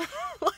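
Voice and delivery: quick squeak of a laugh; short high laugh. Real, not acted. Laughing at her own point about vegetables, a short high laugh. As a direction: laugh at your own silly line.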